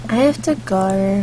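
quiet, drawn-out brush-off; sullen daughter. Acted. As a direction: drag it out like a moody teenager. Sullen daughter leaves breakfast.

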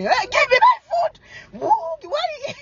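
put-on high, slow, shrieking child's yell; imitating a screaming boy. Mother imitating her son yelling from the back seat, high shriek. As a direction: mimic a little boy wailing for his stolen food. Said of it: "one of the boys screaming".

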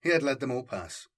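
ordinary delivery, nothing marked

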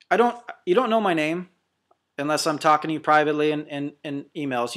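ordinary talk, nothing marked

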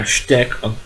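ordinary talk, nothing marked

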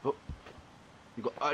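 ordinary talk

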